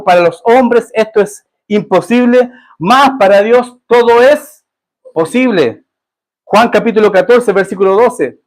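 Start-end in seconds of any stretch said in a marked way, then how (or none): 0.0-5.8 solemn scripture quote. Acted. Quoting Jesus in scripture reading.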